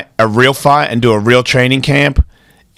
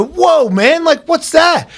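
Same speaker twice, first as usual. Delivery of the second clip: surprised quote; 12 semitones higher, slower than usual. Retold or imitated. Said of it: "I go".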